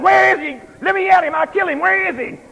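husband hamming up his jealous rage; mock jealous outrage. Jealous husband in retold story.

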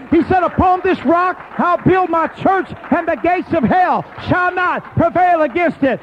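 loud, high-pitched revival preaching; preacher in full cry; full-force preaching. Real, not acted. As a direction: shout it out to the whole church, building to a peak.

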